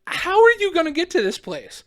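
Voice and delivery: imitated disbelieving officer; mock incredulity. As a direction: quiz him like a baffled cop. Retold cop questions him.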